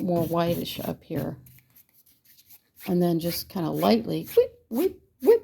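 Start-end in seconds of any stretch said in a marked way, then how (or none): 4.4-5.4 high quick whip sounds; whip noises. Shown as a demonstration. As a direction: swish it out like a brush stroke. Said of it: "you have to make the noise".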